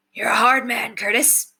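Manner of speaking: lightly voiced bandit mentor, easygoing; a hint of a rough old bandit showing the new kid the ropes; Brendan's voice. A narrator's reading. Brendan praising Curtis.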